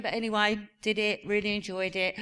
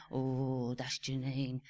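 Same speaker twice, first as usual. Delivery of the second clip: low, soft aside from son; imitated TV commentary; 6 semitones lower, 6 dB quieter, much slower than usual. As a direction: mimic a viewer's low running commentary. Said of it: "running commentary with Oliver saying".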